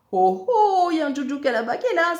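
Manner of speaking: high, loud, fast alarm call; shrieked heads-up; crying out. A narrator's reading. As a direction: holler a warning to scare off the prey. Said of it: "s'écrie Zekeye".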